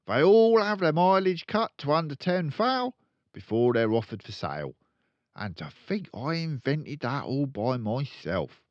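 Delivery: loud, high, boastful dad; proud father. Acted. Father boasting to the family, loud and proud. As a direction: puff up with pride. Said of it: "he added proudly".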